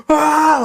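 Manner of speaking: высокий громкий шутливый вскрик ужаса; ахнуть, как будто увидела Медузу; mock horrified gasp. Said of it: "like she's gonna go".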